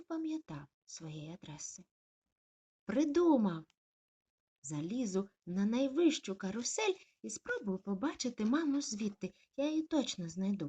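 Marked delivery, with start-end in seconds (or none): 2.8-10.7 high, loud eager plan; sudden idea. Acted. Puppy deciding to climb carousel, eager. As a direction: burst out with a bright idea. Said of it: "подумав малюк".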